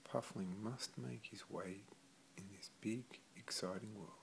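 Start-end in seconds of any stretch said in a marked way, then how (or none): none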